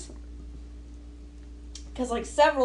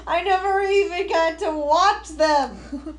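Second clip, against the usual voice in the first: exasperated outcry; 6 semitones higher, 6 dB louder than usual. Real, not acted. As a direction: wail it like a wronged fan. Mock outrage over missed dragon.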